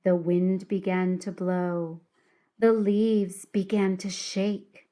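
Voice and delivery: plain reading voice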